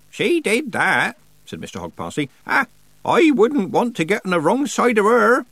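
put-on high-pitched old man, emphatic; old countryman. A narrator's reading. Old man respects Mistress Weatherwax, emphatic and high. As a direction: agree heartily in a rustic old voice.

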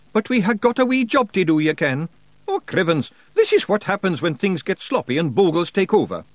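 high, broad Scots wee man, cheeky and gruff; Scottish pictsie Rob. Acted. Pictsie chief thanking nobody for thanks, in a high Scots voice.

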